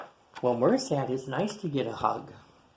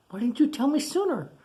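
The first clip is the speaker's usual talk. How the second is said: high alarmed question; 11 semitones higher, faster than usual. Acted. Scolding a friend for the late news, high and alarmed. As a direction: squeak out the complaint in a rush of alarm.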